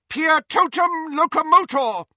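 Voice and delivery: high, loud incantation; crying out a spell. Acted. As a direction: cry the spell with force. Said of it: "cried Professor McGonagall".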